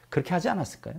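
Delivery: ordinary talk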